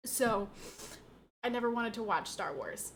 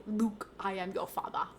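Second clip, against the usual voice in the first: Darth Vader line; 3 semitones lower than usual. Retold or imitated. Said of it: "that's the way I hear it in my head".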